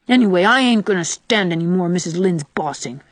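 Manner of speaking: ordinary delivery, nothing marked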